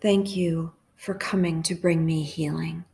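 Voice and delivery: hushed, even prayer with long pauses; guided meditation prayer. Real, not acted.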